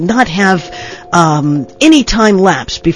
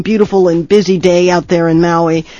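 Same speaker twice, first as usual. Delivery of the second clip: usual speaking voice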